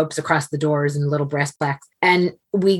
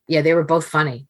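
ordinary talk, nothing marked

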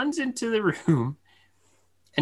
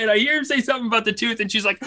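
normal talking voice

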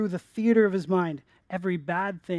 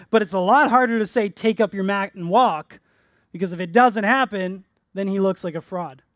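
louder, higher, driving sermon voice; preaching at full force; 4 semitones higher, 7 dB louder than usual. Acted. Pastor driving home Jesus' point to the congregation, full force. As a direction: press the point home to the church.

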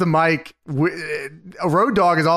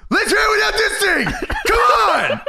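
wrestler promo shout. Retold or imitated. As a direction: rouse the crowd like a wrestler. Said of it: "To the crowd".

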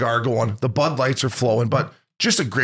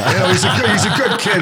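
laughs through the words, higher and louder; chuckling while getting the words out; laughing; 7 semitones higher, 5 dB louder than usual. Real, not acted. Laughing at the retold quip.

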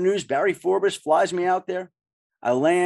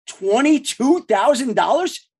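slow, higher-pitched, rising question; amazed echo; 4 semitones higher, 3 dB louder, slower than usual. Retold or imitated. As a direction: repeat the figure in drawn-out amazement. Echoes salary back in amazement.

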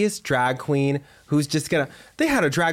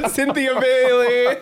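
laughing; 11 semitones higher, 3 dB louder, slower than usual. Real, not acted. Delighted at friend's housewife pick.

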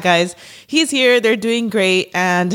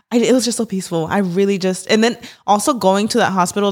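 ordinary talk

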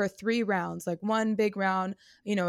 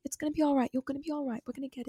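higher and softer, soothing a crying dancer; reassuring darling; 6 semitones higher, 6 dB quieter than usual. Retold or imitated. Choreographer softly comforting the crying auditioner, tender and hushed. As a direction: coo it gently, like calming someone in tears. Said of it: "And she's like".

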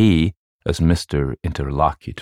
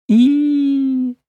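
high, loud "eee" shriek; children's squeal; 5 dB louder than usual. A narrator's reading. Children handed the witch's arm, squealing. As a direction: squeal in delighted disgust. Children react to grisly item.

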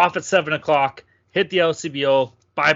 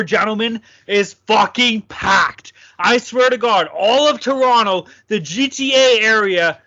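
raised pitch, loud, drawn-out opening; loud emphatic rant; 5 semitones higher, 5 dB louder than usual. Real, not acted. Host tells co-hosts about crowded downtown Toronto, loud emphatic rant. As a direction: hammer out each phrase in exasperated disbelief. Storyteller exaggerates the packed downtown.

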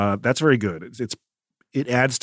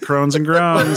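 laughing; 6 semitones higher, 6 dB louder, much slower than usual. Real, not acted. Repeating the title laughing.

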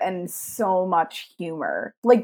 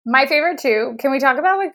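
ordinary talk

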